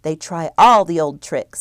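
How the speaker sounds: plain reading voice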